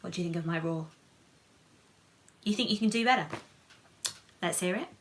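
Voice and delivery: ordinary delivery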